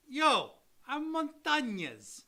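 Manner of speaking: loud, high, drawn-out fake Jersey accent; horrible Jersey accent. Host trying out a viewer's surname in horrible Jersey accent. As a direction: ham up a bad Jersey accent, stretching it out. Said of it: "That's a horrible Jersey accent".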